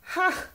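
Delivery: one high burst of laughter; a single bright "Ha!" of delight; sharp laugh. Acted. Friend bursting out laughing at the moo dog.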